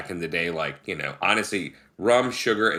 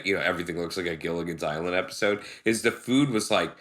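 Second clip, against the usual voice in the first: normal talking voice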